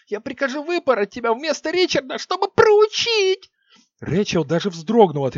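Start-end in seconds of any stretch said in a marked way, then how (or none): 0.1-3.5 high screaming threat, stress on Ричарда; furious shrieking threat. Acted. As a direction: shriek it, landing hard on Ричарда. Queen threatening flogging.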